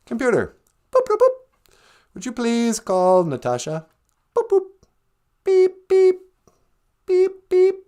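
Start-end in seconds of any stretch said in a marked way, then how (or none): 0.9-1.7 very high robotic boop boop; chirpy mock computer beeps. 5.4-7.7 very high robotic boop boop; chirpy mock computer beeps.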